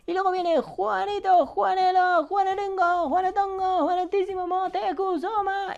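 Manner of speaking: very high, stretched announcing voice; high announcer falsetto. Acted. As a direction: announce the name in a squeaky falsetto. Presenta a un seguidor, voz aguda. (voz aguda de presentador)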